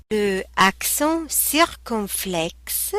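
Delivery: "Le accent circonflexe" slow and deliberate; slow textbook pronunciation. Shown as a demonstration. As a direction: pronounce "circonflexe" carefully for learners. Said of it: "pronunciation lesson demo".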